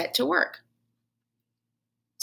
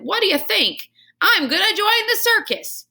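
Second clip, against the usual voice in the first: much higher, louder boast; proud showman; 13 semitones higher, 5 dB louder than usual. Acted. Janitor boasting to the hamster after his trick. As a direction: announce it like a circus star.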